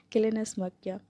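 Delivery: plain reading voice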